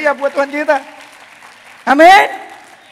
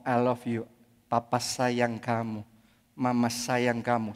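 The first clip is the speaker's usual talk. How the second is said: soft, low and slow; soft loving words to a child; tender; 10 semitones lower, 9 dB quieter than usual. Shown as a demonstration. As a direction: say it gently like to your child.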